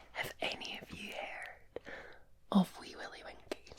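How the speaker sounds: ordinary talk, nothing marked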